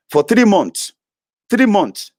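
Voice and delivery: three months hit high and loud; angry emphasis. Real, not acted.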